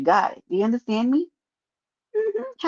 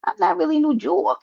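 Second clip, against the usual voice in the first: mock quote; 7 semitones higher than usual. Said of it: "They said".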